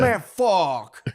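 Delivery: put-on punchy, high Puerto Rican-accented curse; thick Puerto Rican accent. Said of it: "he didn't know how to say".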